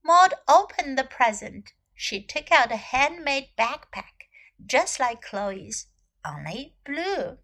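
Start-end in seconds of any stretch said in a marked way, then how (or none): none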